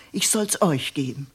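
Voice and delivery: ordinary delivery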